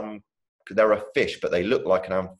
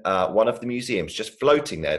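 plain reading voice

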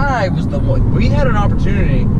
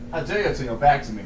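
lightly voiced quiet imperial challenge; Gladiator quote; 3 semitones lower, 9 dB quieter than usual. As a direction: recite the emperor's challenge low and hushed. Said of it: "My name is Maximus Decimus".

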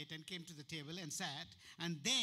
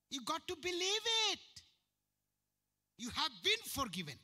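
raised, loud exhortation; fervent loud preaching; preaching shout; 9 semitones higher, 5 dB louder than usual. Real, not acted. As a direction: lift it up and drive it home.

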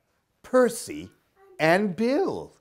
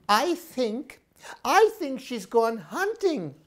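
higher, thoughtful sister voice; big sister owl; 8 semitones higher than usual. Acted. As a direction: reason it out like a sensible big sister. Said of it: "said Sarah".

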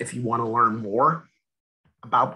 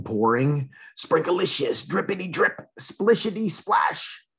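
ordinary delivery

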